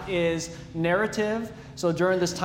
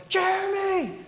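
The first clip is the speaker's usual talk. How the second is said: high, loud desperate call, stretched at the end; trapped in the bathroom, shouting for Jeremy; calling through the door; 4 dB louder, slower than usual. Retold or imitated. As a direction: yell for your brother from behind a locked door. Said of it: "I called through the door".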